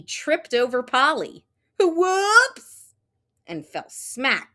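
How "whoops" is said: high, loud startled cry; whoops. Acted. Froggy trips over Polly, high startled yelp. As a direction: yelp as you trip.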